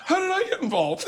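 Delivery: high-pitched helpless protest, half laughing; squeaky put-on dismay; mock bewilderment. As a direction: imitate an innocent victim whining in confusion. Acts Jay's bewildered protest.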